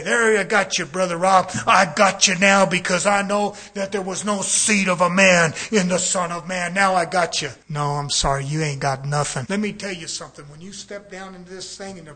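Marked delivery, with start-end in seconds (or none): 0.0-7.5 louder, gloating taunt of a critic who thinks he has caught the preacher; gloating challenger. Retold or imitated. Preacher voices a critic taunting him, in a loud gloating voice. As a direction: crow that you've trapped him. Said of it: "And you say".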